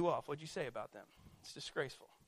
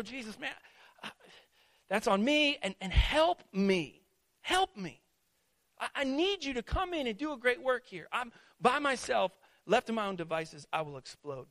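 high, urgent plea to Jesus; pleading prayer; 6 semitones higher, 5 dB louder than usual. Acted. Preacher acting out a convicted believer begging Jesus for help, pleading. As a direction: cry out for help mid-sermon, voice raised.

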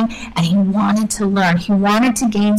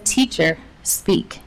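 softer, nearly whispered request; hushed respect; 5 semitones lower, 5 dB quieter, slower than usual. Retold or imitated. Simon asking Jesus to speak, hushed and respectful. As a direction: humbly invite the teacher to talk. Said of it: "And so he says".

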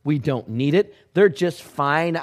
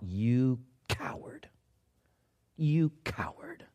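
slow, unvoiced whisper of scorn; whispered contempt; 10 dB quieter, slower than usual. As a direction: hiss the insult under your breath. Silent thought, whispered.

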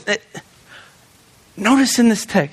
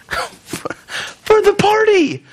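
high, drawn-out laughing words; 11 semitones higher, much slower than usual. Real, not acted. Preacher amused at the host's plight, real laughing voice. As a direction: laugh your way through it, pitch jumping up.

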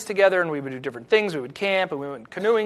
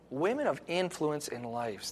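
softer and slower, letting the phrase sink in; soft, slow repeat; 4 semitones lower, 12 dB quieter, slower than usual. Shown as a demonstration. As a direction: repeat it quietly and slowly for them to think about. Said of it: "Think about that".

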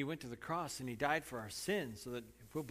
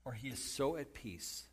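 ordinary talk, nothing marked

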